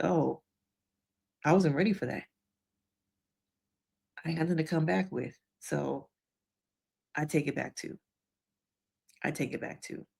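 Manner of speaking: soft, halting concession, as if caught off guard; hushed, understated surprise trailing into a small voice; retold stunned reaction. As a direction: imitate someone quietly taken aback, with long pauses. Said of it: "Trina was actually kinda stunned".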